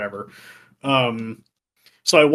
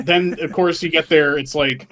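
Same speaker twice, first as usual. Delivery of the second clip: usual speaking voice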